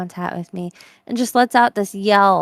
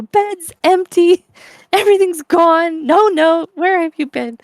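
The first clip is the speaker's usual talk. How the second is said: higher, louder frantic mother; Molly Weasley panicking; 7 semitones higher, 5 dB louder than usual. Retold or imitated. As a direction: wail it like a panicked mum finding an empty bed. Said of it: "that whole skit where Molly's like".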